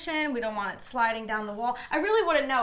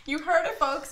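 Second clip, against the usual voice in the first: ordinary delivery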